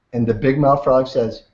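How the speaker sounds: usual speaking voice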